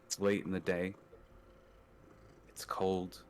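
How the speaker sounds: slow, measured scene setting; game master setting the scene; scene narration. Acted. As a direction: paint the cold mountain for the table.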